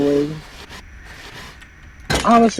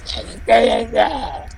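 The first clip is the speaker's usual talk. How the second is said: character voice; 6 semitones higher, slower than usual. Acted. Echoing louder and slower.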